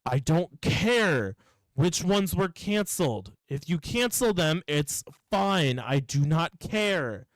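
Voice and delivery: slow, deliberate mock exasperation; exasperated insistence. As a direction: drag out each point to a stubborn customer. Acted quarrel with customer.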